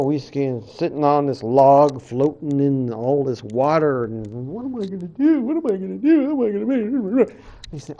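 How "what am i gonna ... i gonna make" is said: high-pitched, repetitive mock worrying; hammed-up fretting. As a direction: fret like a lost cartoon character. Coyote frets alone on his log.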